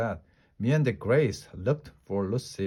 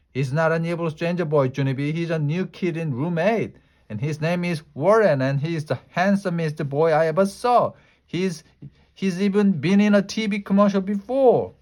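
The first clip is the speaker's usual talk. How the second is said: high, fast and louder; excited gushing; 6 semitones higher, 5 dB louder than usual. A narrator's reading. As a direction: rave about the boy. Lucille angry, then gushing.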